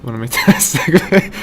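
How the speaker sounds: laughing through the question; cracking up. Real, not acted. Cracks up repeating his question.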